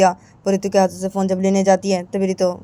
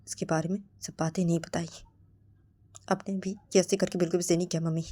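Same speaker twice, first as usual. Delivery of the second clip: much softer, hurt reproach; quiet reproach; 11 dB quieter than usual. Acted. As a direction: murmur the blame softly. Ritu reproaches mother quietly.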